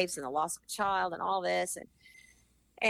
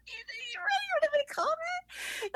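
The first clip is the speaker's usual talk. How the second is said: mock disbelief; slower than usual. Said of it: "so I was like".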